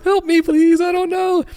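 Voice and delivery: cracking plea. Retold or imitated. Young copywriter pleading with director, voice cracking high. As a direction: beg in a panicked, breaking high voice. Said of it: "my voice is cracking".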